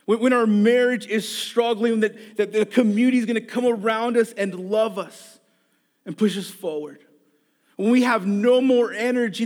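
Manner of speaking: impassioned pulpit delivery; preaching. Acted. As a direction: preach it to the congregation with fire. Preaching the moral of story.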